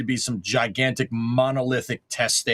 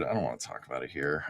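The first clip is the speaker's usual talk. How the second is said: much lower, much softer and fast; embarrassed mutter; 10 semitones lower, 9 dB quieter, faster than usual. Real, not acted. Dodging the car lift story, muttered low and fast. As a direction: brush it off under your breath.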